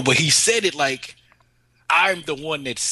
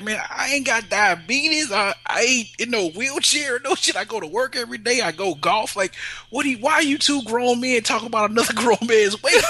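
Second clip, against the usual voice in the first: higher, mock-defensive rant; indignant friend; 9 semitones higher than usual. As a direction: defend yourself, half-laughing. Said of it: "He's like".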